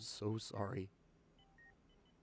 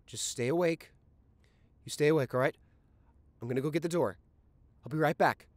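urgent; 6 semitones higher, 8 dB louder than usual. Acted. Urgent boyfriend keeping her awake.

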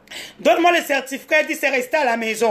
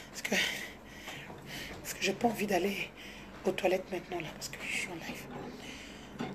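hushed aside, dropping to a near whisper; 7 semitones lower, 19 dB quieter than usual. Real, not acted. To someone off-camera while streaming, a hushed whisper. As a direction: mutter it off-mic so the audience barely hears. Quiet aside during the live.

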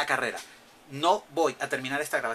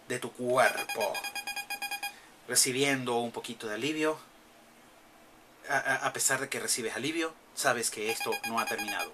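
soft, hushed storybook reading; softer dramatic narration of the game's words; reading game text; 2 semitones lower, 5 dB quieter than usual. Acted. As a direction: read the lore quietly and evenly.

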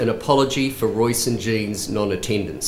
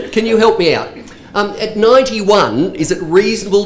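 calling out to audience; 7 semitones higher, 5 dB louder than usual. Real, not acted. Asking the audience for a show of hands.

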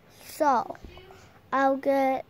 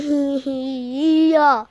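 laugh. Acted. Character laughs.

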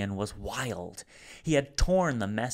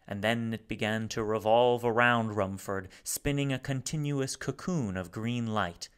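ordinary delivery

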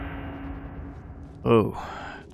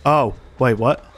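ordinary talk

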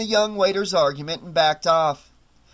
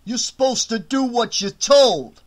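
bossy thug; 7 semitones higher, 5 dB louder than usual. Acted. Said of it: "Tony concluded".

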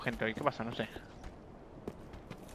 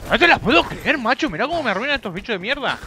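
exasperated outburst; 9 semitones higher, 7 dB louder than usual. Real, not acted. Monsters ruining his run.

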